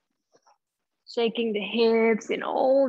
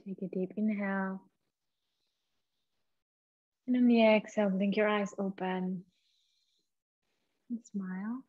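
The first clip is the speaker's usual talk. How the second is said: soft, hushed meditation voice with long pauses; guided meditation; 11 dB quieter than usual. Acted. Leads a guided breathing meditation.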